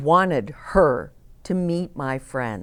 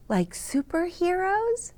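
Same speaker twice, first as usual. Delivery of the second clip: put-on higher, softer girl's voice, asking; Nellie's voice; 11 semitones higher, 5 dB quieter than usual. A narrator's reading. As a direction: pitch up as a small hopeful girl. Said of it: "Nellie said".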